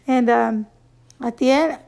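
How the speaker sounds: ordinary talk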